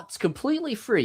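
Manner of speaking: high sarcastic echo. Retold or imitated. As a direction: sneer the ad's promise. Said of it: "sarcastic repeat of the ad".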